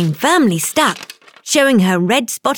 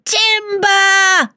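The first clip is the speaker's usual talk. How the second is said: shouting; 10 semitones higher than usual. Acted. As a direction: yell it as the tree falls. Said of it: "followed by someone shouting".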